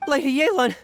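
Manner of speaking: loud, high call across the room. Acted. Wu Pei spots Yelan after searching, calls out. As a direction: call her name in relief from a distance.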